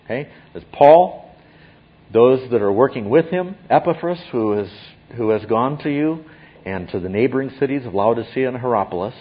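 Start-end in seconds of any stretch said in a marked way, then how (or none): none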